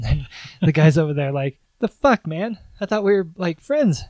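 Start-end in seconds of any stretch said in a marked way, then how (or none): 1.8-4.0 higher, louder, rising outrage on friends; hurt and offended, climbing on friends; indignant bystander. Retold or imitated. Bystander hurt that the hero forgot him, rising mock indignation.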